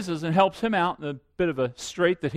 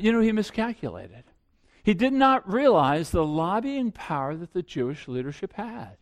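ordinary talk

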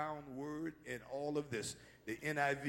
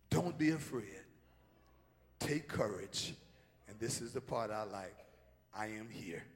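gentle reassurance; 5 semitones lower, 5 dB quieter than usual. Acted. Voicing Jesus gently.

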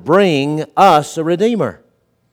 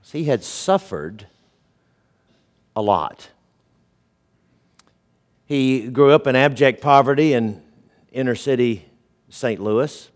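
ordinary talk, nothing marked

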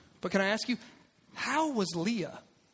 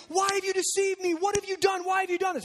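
angry outcry; 9 semitones higher, faster than usual. Acted. Jacob shouting at Laban on waking. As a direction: yell it at the man who tricked you.

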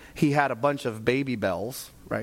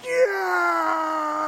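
cheering; 12 semitones higher than usual. Retold or imitated. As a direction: cheer like the match was just won. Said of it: "we were like".

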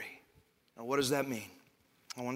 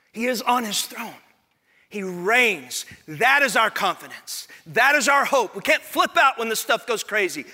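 loud, high, emphatic declarations; full-force preaching; 8 semitones higher, 7 dB louder than usual. Real, not acted. Preacher assures the congregation of their hope, fervently. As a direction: drive each declaration home to the crowd.

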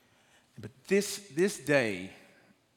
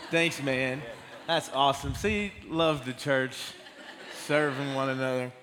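laughing, louder thanks for the water; 2 semitones higher, 4 dB louder than usual. Real, not acted. Speaker thanks the helper for water, laughing. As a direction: laugh through a grateful thanks.

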